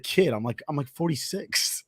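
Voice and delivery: soft imitated grumble to oneself; exasperated thinking aloud. Golfer to himself after being called kid, softly exasperated. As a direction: imitate a quiet, put-upon aside. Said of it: "exasperated at the freaking audacity".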